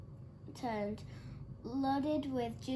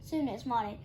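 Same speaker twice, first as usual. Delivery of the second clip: ordinary delivery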